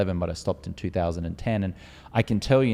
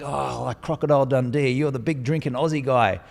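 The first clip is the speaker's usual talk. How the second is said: a lightly put-on, higher eager American fan; mock the gushing Americans lightly; higher, starstruck fan voice, lightly done; imitating Americans; 4 semitones higher than usual. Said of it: "The Americans are like".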